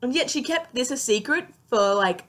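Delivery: usual speaking voice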